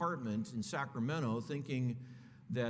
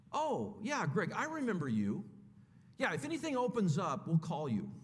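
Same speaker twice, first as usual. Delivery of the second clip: dismissive brush-off; 6 semitones higher than usual. Retold or imitated. College president brushes him off.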